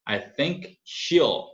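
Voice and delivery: "she'll" stretched with a long ee sound. Shown as a demonstration. Teacher showing students the long vowel in "she'll". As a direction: draw out the ee in "she'll" slowly. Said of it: "she'll e longo".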